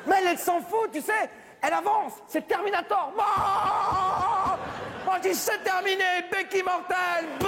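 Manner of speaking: hyping up the fight like an action scene; excited retelling. Retold or imitated. Racontant la béquille à sa copine, voix haute et excitée.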